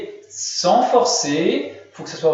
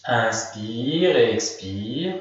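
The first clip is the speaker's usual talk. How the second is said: drawn-out, slow breathing cue; 7 semitones lower, 3 dB quieter, much slower than usual. Shown as a demonstration. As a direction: stretch each word like a long breath. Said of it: "guides breath in a relaxation".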